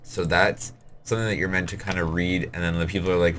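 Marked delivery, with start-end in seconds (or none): none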